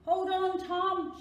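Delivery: loud, high urgent shout. A narrator's reading. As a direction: grandfather shouting to a falling child. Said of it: "shouted Granddude".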